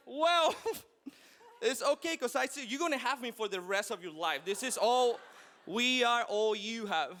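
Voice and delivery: mock-indignant parent, voice jumping up; indignant retort. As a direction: set the kid straight, playing offended dad. Acted retort to his son.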